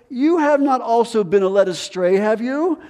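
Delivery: high, sneering taunt; mocking taunt. Pharisees taunting the officers, high mocking sneer. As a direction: needle them with a scornful question. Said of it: "They start to mock him".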